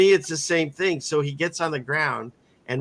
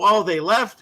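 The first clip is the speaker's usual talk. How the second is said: higher and louder, a woman noting they left; imitating the woman; 7 semitones higher, 5 dB louder than usual. The accused woman answering Jesus, higher, lightly surprised. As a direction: voice her surprised, lightly raised. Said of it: "she's all".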